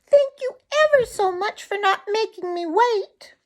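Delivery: very high, thin little bird; tiny bird voice. Acted. Tommy Tit thanks Drummer.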